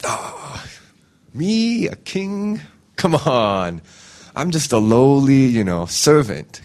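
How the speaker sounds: hammed-up false humility; falsely humble. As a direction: fake modesty, fishing for praise. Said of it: "a falsely humble person would be like".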